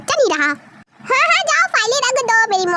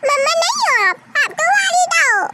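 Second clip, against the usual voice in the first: put-on child voice; 7 semitones higher than usual. Child corrects aunt.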